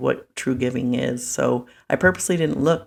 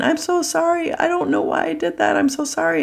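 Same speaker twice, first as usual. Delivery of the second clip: very high-pitched mock wail; crying apology; 14 semitones higher than usual. Crying lady holding on to the giver, tearful wail. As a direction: imitate a hysterical, pleading stranger in tears. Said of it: "And she's crying".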